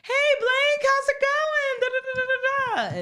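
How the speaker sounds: greeting the regular with forced cheer; fake casual greeting. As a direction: sing it out overly casual. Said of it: "Let's make this not awkward".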